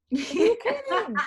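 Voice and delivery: high, loud laughing disbelief. Retold or imitated. Celebrity learning the whole day was staged, laughing. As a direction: gasp it out, laughing. Guest realises the prank.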